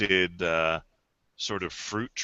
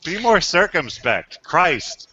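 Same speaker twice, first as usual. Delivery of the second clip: loud exclamation; 9 semitones higher, 10 dB louder than usual. Real, not acted. GM laughing, scolds player loudly.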